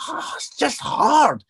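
high, slow sigh of struggle; mock-weary, drawn-out complaint; acted exasperation. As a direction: groan it out as if worn down. Voicing a weary listener's feeling.

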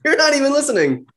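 high, loud laughing protest. Real, not acted. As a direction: call him out loudly, half laughing. Teasing protest at co-host.

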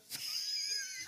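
a shrieking burst of laughter. Real, not acted. Reacts to blind woman.

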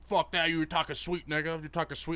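puffed-up retold aggression; retold street challenge. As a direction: imitate someone picking a fight. Retells the hostile stranger's challenge.

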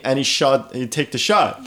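ordinary talk, nothing marked

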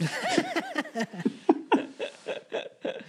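a long, high burst of real laughter. Real, not acted. Telling a friend about the doctor, high real laughter. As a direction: crack up at the punchline of your own story.

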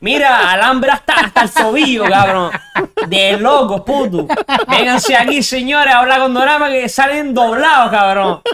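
high, laughing hype call to a friend; excited calling out. Acted. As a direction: shout it up high, half laughing. Calling Kevin in Bali, laughing.

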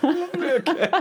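high, giggling okay; laughing. Real, not acted. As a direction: laugh the word out.

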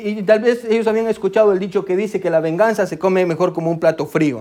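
normal talking voice

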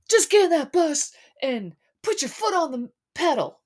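high, loud mock-angry yell; [name]. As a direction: shout an order, imitating a man in an argument. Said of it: "[name] goes".

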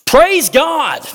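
high, loud shout of praise; a jubilant shout of praise, high and loud. Acted. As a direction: cry it out to God. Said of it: "came back to Jesus shouting".

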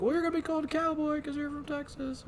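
lightly mocking high sergeant voice; drill instructor. Host voicing the drill instructor naming Cowboy, high. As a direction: toss off the nickname like a sergeant. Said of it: "he's just like".

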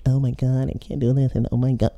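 high whiny mock complaint; mock whiner. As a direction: whine it like a drama queen. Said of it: "It's being a whiner".

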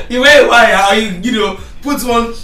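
laughing through the words. Real, not acted. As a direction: giggle out the joke. Joking about wearing a wire.

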